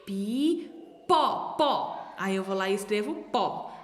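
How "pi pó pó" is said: ordinary talk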